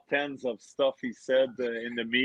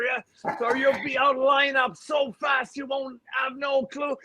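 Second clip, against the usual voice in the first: Tortorella impression; 11 semitones higher than usual. Hockey player mimicking his coach ranting at teammates, shouting. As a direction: chew out the players as the furious coach. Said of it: "pretending I'm Torts".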